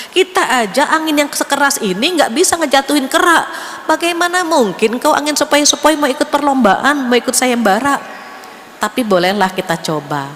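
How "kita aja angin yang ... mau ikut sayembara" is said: high, scornful mockery of the little breeze; tornado winds jeering, pitched up; mocking big winds. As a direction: sneer down at the small one from above.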